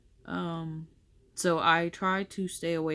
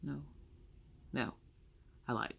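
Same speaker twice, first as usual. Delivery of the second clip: ordinary delivery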